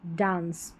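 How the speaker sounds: डांस with a long open aa, like British dance; alternate pronunciation. Shown as a demonstration. Teacher models the other reading for students, softly. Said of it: "डांस करके भी pronounce कर सकते हैं".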